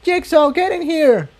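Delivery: very high urgent call. A narrator's reading. Tiptoe calls Jigsaw to the airship, high urgent call. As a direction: call across the room. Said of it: "Tiptoe called".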